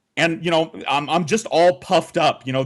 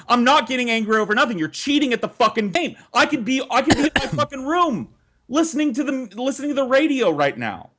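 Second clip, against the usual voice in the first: heated denial; 10 semitones higher, faster than usual. Retold or imitated. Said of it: "I get more and more heated".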